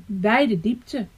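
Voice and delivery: ordinary delivery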